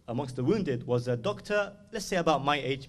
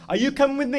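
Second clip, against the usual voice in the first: high, raised ringing call; louder and higher, summoning someone; acted urgent call; 12 semitones higher, 9 dB louder, faster than usual. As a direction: call out the question to each follower. Imam's call repeated, acted.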